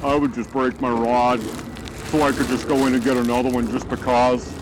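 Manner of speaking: lightly disguised anonymous interviewee voice. Pretend. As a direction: mask your voice like a hidden witness. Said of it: "We've changed his voice with this voice modification software".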